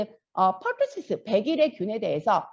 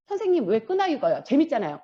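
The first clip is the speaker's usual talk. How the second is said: ordinary delivery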